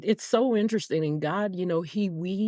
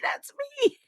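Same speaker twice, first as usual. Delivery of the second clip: squealed, much higher; thrilled recognition. Retold or imitated. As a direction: mimic him hearing himself on the podcast, overjoyed. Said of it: "and be like".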